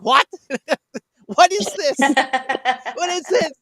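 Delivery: high, loud comic disbelief; hammed-up outraged confusion; mock bafflement. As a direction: act out an exasperated learner, voice shooting up. Acts out baffled, exasperated English learner.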